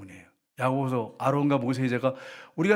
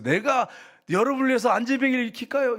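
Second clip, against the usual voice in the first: higher, arrogant offer; mocking boast; 8 semitones higher, 3 dB louder than usual. Pastor mocking himself offering miracles, arrogant. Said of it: "생각해 보세요".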